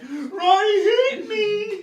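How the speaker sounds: very high, slow, drawn-out bawl; mock crying wail. Brother mimics sister crying to Dad, hammed-up wail. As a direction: bawl like a tattling child.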